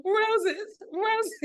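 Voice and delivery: high, loud bravo cheer; delighted cheer. Real, not acted. Praising her friend's performance with a high bravo cheer. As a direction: cheer like tossing roses on stage. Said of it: "applauding a performance".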